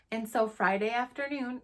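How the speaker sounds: usual speaking voice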